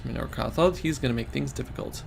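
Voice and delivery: plain reading voice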